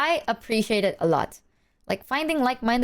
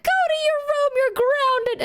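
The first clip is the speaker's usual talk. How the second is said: mock angry mom. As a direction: scold like a shrieking mom, hammed up. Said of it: "She was like".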